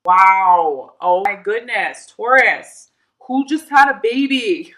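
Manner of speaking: loud, drawn-out amazed exclamation; delighted surprise. Real, not acted. Tarot reader suddenly sees a baby for Taurus, delighted surprise. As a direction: burst out loudly, stretching the words in excitement.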